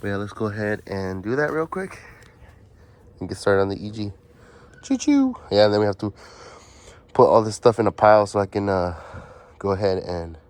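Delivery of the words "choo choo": train whistle. Pretend. Playful train noise.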